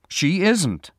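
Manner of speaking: high, loud denial; quick reply. A narrator's reading. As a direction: cut in at once, emphatically. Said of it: "Sam said quickly".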